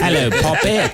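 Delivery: high laughing cartoon English accent; hammed-up Cockney greeting; mock English accent. Co-host joins the English accent bit, high and laughing. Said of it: "Do an English accent".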